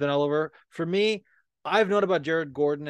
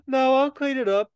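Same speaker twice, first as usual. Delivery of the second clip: high mock voice of her; squeaky quoted reply; imitating her; 13 semitones higher, 4 dB louder than usual. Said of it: "She's like".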